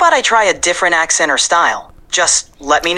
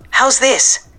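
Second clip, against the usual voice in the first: higher feminine take, New York Puerto Rican; female voice; 7 semitones higher than usual. Shown as a demonstration. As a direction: lift it into a woman's voice. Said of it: "Can it be female?".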